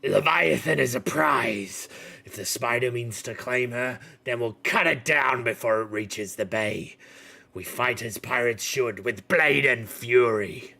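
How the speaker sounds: loud, proud pirate captain; rally the crews to fight; Ralmar's battle cry. Acted. Captain Ralmar urges the council to fight, loud and proud. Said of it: "and he goes".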